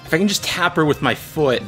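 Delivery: normal talking voice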